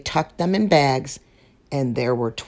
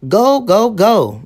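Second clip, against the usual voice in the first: higher and louder, urging him to go; cheering on; 7 semitones higher, 8 dB louder, slower than usual. Acted. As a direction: push the little one out the door with cheer. Mama cheering Sam on.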